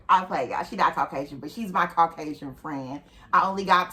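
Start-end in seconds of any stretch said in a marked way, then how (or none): none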